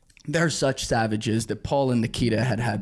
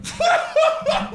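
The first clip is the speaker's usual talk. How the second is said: high cackling laugh; 6 dB louder than usual. Retold or imitated. Trevor bursts out laughing.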